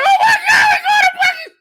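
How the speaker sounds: very high, loud screaming squeal; excited kid at Christmas. Retold or imitated. Comedian reliving hearing of the trip, ecstatic shriek. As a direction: shriek it like an overjoyed child. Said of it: "lit up like a kid at Christmas".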